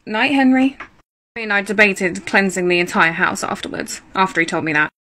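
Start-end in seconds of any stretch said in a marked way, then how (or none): 0.1-0.8 uneasy goodnight to ghost. Retold or imitated.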